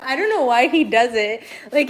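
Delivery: ordinary talk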